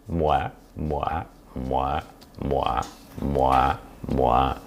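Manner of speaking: soft, low repeated me me; quietly mocking vanity; mock self-centred. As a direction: murmur it like a self-absorbed politician.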